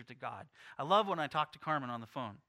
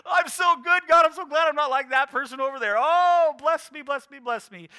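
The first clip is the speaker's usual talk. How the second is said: much higher, shouted smug prayer; self-righteous believer; 10 semitones higher, 14 dB louder than usual. Pretend. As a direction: ham up a pompous self-praising prayer. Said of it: "When we come and go".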